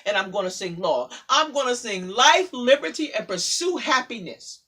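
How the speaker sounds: loud, rousing spoken word; poem recital. Acted. Poet drives her poem to a loud rousing close. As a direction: declaim it with growing fire.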